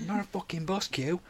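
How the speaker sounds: hammed-up road-rage mum snapping at shoppers; mock angry mum. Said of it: "she's like".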